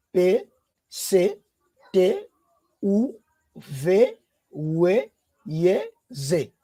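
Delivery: letters called out one by one with pauses; teacher drilling the alphabet; reciting the alphabet. Shown as a demonstration. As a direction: recite each letter slowly for the class. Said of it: "Elles sont là".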